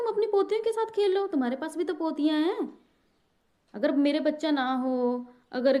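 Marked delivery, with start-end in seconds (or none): 0.0-2.8 high-pitched retort. Retold or imitated. Retort to mother-in-law retold.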